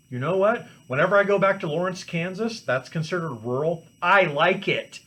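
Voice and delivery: usual speaking voice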